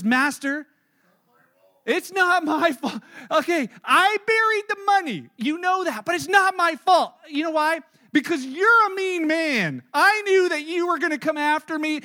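high, loud, whining servant blaming his master; whiny blaming shout. Retold or imitated. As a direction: plead your case loudly, it's not your fault.

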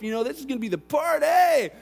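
loud, high, excited shout; whooping about the party; party excitement. Acted. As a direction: cheer it like the best night ever. Son imagines wild party.

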